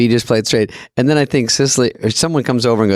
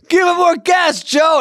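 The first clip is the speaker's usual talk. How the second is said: high-pitched imitation of calling out; urgent mock shout. Imitating the guy pumping beside him, high urgent shout. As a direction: call out high, urging a crewmate on. Imitates pump man urging crewmate.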